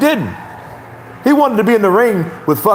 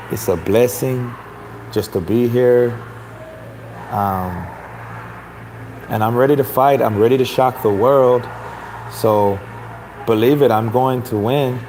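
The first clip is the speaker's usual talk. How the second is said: Andy Ruiz; 4 semitones lower, 4 dB quieter than usual. Acted. Host mimics the fighter thankful to be here, mellow and humble. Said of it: "he comes in all humble and shit".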